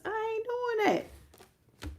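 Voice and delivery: a squeaky mocking refusal. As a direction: sneer it slowly. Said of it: "I ain't giving you shit".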